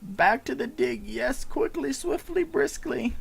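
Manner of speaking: high, eager little goblin voice; voiced NPC.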